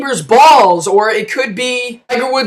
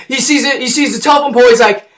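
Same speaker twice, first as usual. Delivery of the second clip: ordinary talk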